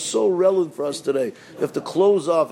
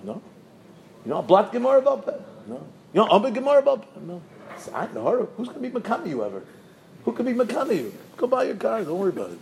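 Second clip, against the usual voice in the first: normal talking voice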